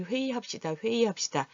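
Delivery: 회의합시다 said as "hwe-ee-hapsida". Shown as a demonstration. Teacher gives everyday example, 회의합시다 with "ee". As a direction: run 회의 smoothly as "hwe-ee" in 회의합시다. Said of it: "이렇게 나는 소리가".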